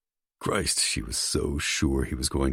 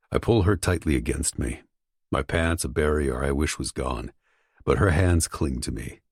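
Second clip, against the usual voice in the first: ordinary delivery, nothing marked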